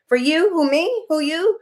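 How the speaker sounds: high, disbelieving "who, me?" act; teasing high-pitched question and answer; playful mock questioning. Acts out a who-me exchange.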